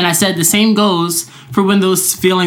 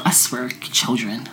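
exasperated; 6 semitones lower, 9 dB quieter, slower than usual. Real, not acted. Exasperated about kids.

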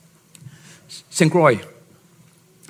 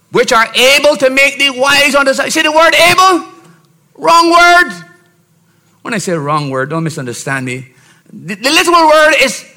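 preaching at full force; 8 semitones higher than usual. Acted. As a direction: preach it out to the congregation. Preacher building up.

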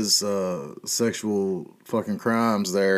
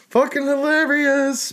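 mock amazement; 14 semitones higher, slower than usual. Real, not acted. Repeated with mock drawn-out emphasis.

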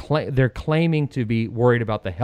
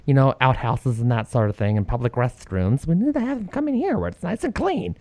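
high, sugary put-on voice of city officials; mocking officials; 6 semitones higher than usual. Host mocks Seattle officials' reasoning, high mocking voice. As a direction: parody the do-gooder bureaucrats, pitched up. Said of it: "They say".

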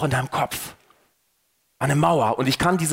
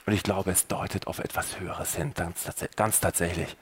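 much softer, lower, almost breathed confession; hushed conviction; 10 semitones lower, 9 dB quieter than usual. Real, not acted. Preacher confides his own faith to the listener, hushed. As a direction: lean in and murmur your belief.

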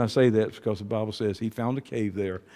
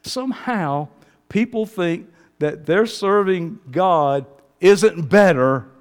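ordinary delivery

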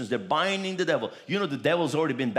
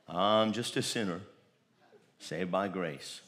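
lower, softer, slow mock whine; meek self-pity; 9 semitones lower, 6 dB quieter than usual. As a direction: mimic a defeated believer, dropping to a mumble. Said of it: "Never ever say again".

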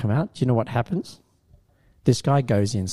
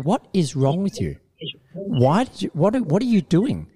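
imitating an exasperated host, higher; retold scolding, pitched up a touch; mock angry host; 6 semitones higher than usual. Host scolding the driver, mock exasperation.

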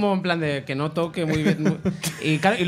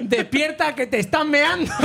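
high, amused wake-up call; mock warning shout; 9 semitones higher, 3 dB louder, slower than usual. Dog owner warning the suitcase's owner, mock urgent. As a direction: call out a warning through laughter.

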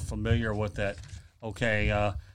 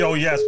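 loud, raised mimicked "oh, yes"; imitated emphatic cry; 4 semitones higher, 7 dB louder than usual. Host who loves the guest's catchphrase, imitating it emphatically. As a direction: punch out "oh, yes" in playful imitation. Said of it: "If that wasn't emphatic".

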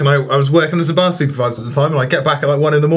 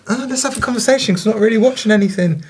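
mocking chatty partner; 6 semitones higher, faster than usual. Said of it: "I've gotta put up with".

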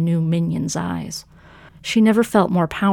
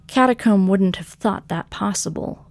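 ordinary delivery, nothing marked

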